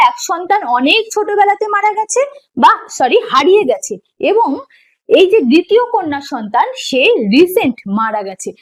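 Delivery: ordinary talk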